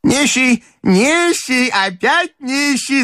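very high exclamation without much volume; крик бабушки. Acted. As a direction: cry out high as an excited grandmother. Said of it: "закричала бабушка". (высокий возглас бабушки)

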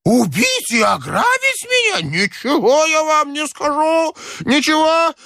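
put-on hysterical high-pitched old man; very high, frantic old man's outburst; crying out. A narrator's reading. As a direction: shriek it in panicked suspicion. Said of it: "Старик так и закричал".

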